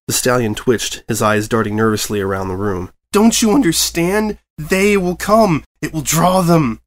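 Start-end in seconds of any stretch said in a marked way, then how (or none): 3.1-6.8 slow, higher, ominous dread; creeping panic, drawn out; paranoid warning. A narrator's reading. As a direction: warn them as if doom approaches.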